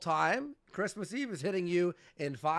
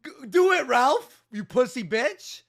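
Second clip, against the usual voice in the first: loud, high jeering street voice; loud mock taunt daring Ralph to fight; 5 semitones higher, 6 dB louder than usual. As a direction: goad him like you want the fight.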